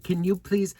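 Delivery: retold desperate plea; urgent plea. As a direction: beg him to hurry over. Begging husband at VIP entrance.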